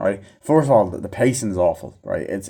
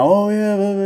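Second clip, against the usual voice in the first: high-pitched parody of chummy chatter; sing-out, high filler banter; mock jolly banter; 10 semitones higher than usual. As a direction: imitate characters laughing along together.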